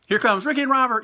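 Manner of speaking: high, loud announcer call; ring announcer. Retold or imitated. Ring announcer introducing the team, big. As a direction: announce the entrance. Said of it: "they announce".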